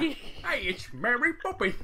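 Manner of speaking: mock-Cockney film parody; London accent. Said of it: "what your London accent sounds like".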